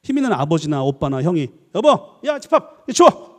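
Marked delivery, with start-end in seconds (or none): 1.8-3.3 high, loud barked orders; bossy shouting. Acted. Powerful father or brother orders the family around, shouting. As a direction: bark it out like a household tyrant. Acts out a domineering family man.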